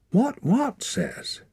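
high, slow, bewildered plea; pleading. A narrator's reading. Boy begs the elders to explain, pleading. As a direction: beg to be told, pitch way up. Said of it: "pleaded Torak".

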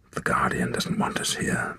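muttered. A narrator's reading. Woman muttering at the raven's cry, low whisper. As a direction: mutter it uneasily. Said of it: "muttered the woman".

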